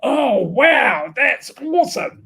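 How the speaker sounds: loud, high-pitched burst of amazement; Australian bloke reaction. Retold or imitated. Australian bloke reacting to his mentee's idea, loudly overexcited. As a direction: blurt out big, blokey enthusiasm. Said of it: "the way an Australian bloke would deal with that".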